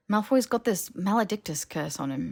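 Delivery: ordinary delivery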